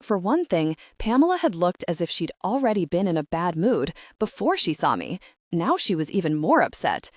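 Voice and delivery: usual speaking voice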